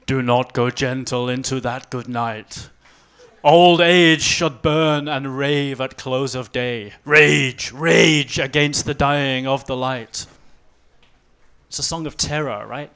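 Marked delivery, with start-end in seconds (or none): 0.1-10.3 slow, loud dramatic poem reading; Dylan Thomas recitation. Acted. Quoting a poem to the audience about ageing, slow loud declaiming. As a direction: declaim the verse slowly and loudly, building on rage. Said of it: "So you start quoting Dylan Thomas".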